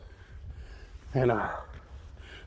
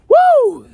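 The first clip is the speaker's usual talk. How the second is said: excited whoop; 6 dB louder than usual. Retold or imitated. Wild ride on horseback.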